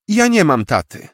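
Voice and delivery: put-on matter-of-fact little boy; small boy. Boy states he has no dad. (mały chłopiec, dużo wyżej, rzeczowo)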